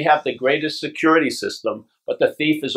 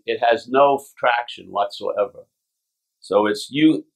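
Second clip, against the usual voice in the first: ordinary talk, nothing marked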